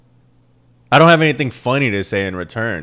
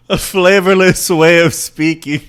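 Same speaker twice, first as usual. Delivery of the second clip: high, loud, drawn-out echo; mock outrage; 9 semitones higher, 7 dB louder than usual. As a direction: repeat the criticism with slow, exaggerated disbelief. Mockingly echoes coworker's verdict on him.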